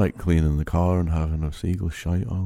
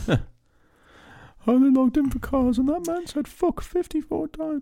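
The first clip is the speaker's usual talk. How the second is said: whiny listener voice; slower than usual. Pretend. Mock listener complaining about swearing.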